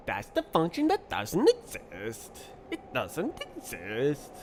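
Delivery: put-on sing-song Norwegian accent with swooping pitch; mock Norwegian accent. Coder lamenting a function that doesn't exist, mock Norwegian accent. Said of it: "Why are you doing a Norwegian accent".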